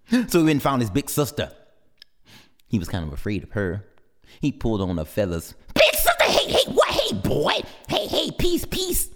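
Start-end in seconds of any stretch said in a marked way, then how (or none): 5.7-9.1 put-on high, nervous kid voice; high-pitched little chick; squeaky little brother. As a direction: tug at her and plead. Little brother pesters big sister.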